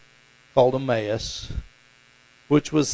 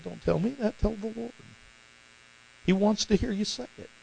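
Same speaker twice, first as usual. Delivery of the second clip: soft, higher, tender redirection; gentle fatherly nudge; 7 semitones higher, 5 dB quieter than usual. Retold or imitated. Father comforting his upset daughter Kaylee, gently. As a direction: softly point her toward prayer.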